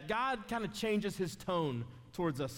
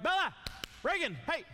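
put-on calling the kids; 7 semitones higher than usual. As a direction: call your daughters across the house. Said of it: "So I'm like".

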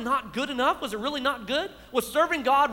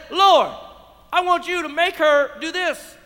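raised, insistent plea to the Lord; loud demand; 5 semitones higher, 7 dB louder than usual. Retold or imitated. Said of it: "She said".

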